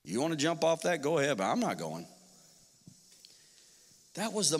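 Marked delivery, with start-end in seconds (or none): 0.0-2.0 much lower, soft scoffing; dismissive scoffing. Pretend. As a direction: brush him off. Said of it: "They said".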